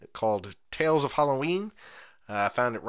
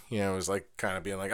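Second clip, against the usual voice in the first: normal talking voice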